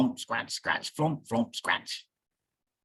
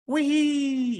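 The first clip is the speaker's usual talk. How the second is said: high, loud gleeful shout; gleeful whee; 13 semitones higher, 4 dB louder than usual. Acted. Bear plays in the waves, yelling with joy. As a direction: squeal it with pure joy. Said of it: "yelled Bear".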